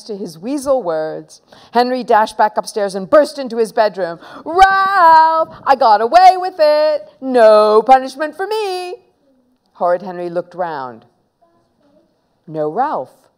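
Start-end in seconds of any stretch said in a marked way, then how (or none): 4.4-9.1 high, loud, jubilant call; triumphant crowing. Acted. Boy telling his friend he got away, triumphant shout.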